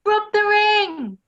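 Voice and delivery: loud, slow call to rub the ring; shouted. A narrator's reading. Audience shouting advice to Aladdin, loud and slow. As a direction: yell it out like a panto crowd.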